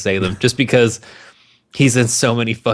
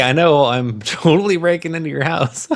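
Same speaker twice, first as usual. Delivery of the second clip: laughing Pitt; 6 semitones higher than usual. Retold or imitated. Voicing Pitt's sheepish grin.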